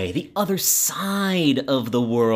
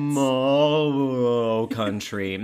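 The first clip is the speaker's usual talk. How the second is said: slow, stretched-out ad-style name; drawn-out savouring; much slower than usual. Acted. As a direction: draw the vowels right out, savouring them.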